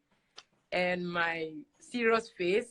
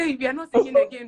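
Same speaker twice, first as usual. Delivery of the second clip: mildly excited, louder, quick quote; excited crowd; 6 semitones higher, 8 dB louder, faster than usual. Retold or imitated. Karaoke crowd giving up the microphone to her, mildly excited. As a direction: blurt it out like an impressed audience. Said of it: "they were so excited".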